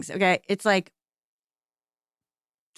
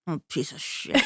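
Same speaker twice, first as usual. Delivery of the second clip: self-scorn; 5 semitones lower, 6 dB quieter than usual. Retold or imitated. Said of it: "I'm like".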